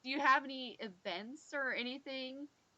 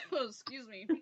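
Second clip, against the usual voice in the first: coughing up a bug; 5 dB quieter than usual. Real, not acted. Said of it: "I might have just inhaled a bug".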